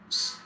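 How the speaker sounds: "s" as an isolated hiss; hissed s sound. Shown as a demonstration. Teacher recaps middle and final S, a hissed "s". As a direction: hiss "s" on its own. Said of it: "we pronounce it as".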